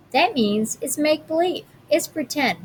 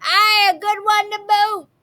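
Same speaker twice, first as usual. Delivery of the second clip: higher, louder pirate chorus; crew agreeing; 9 semitones higher, 3 dB louder than usual. A narrator's reading. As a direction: cheer along as the crew. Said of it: "the others agreed".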